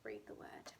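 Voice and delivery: whispered instruction. Shown as a demonstration. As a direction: breathe it without voice. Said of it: "Fred in your head".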